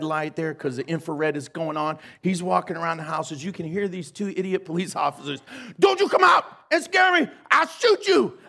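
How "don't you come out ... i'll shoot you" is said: high-pitched, loud jittery warning; mock scared threat. Idiot officers warning ghosts in the dark, mock shouting. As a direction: shout a threat like a spooked cop, hammed up.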